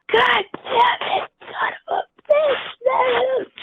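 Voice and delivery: very high, drawn-out yell; imitated yelling. Motorcycle cop at the driver who got pulled over, yelling. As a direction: mimic an enraged cop yelling from afar, high and slow. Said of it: "Just yelling, yelling".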